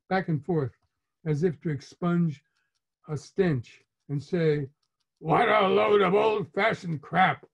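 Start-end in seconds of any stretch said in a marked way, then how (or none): 5.2-7.5 louder, higher disgusted outburst; loud, scornful dad; irritated father. A narrator's reading. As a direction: dismiss it with irritated contempt. Irritated father slapping air at candles.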